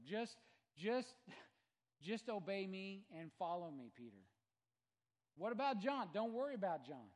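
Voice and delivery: builds to a huge high shout; Jesus commanding, shouted. Retold or imitated. Jesus commands Peter to follow him, rising shout. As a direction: thunder the command. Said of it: "he's just basically gonna ask Peter".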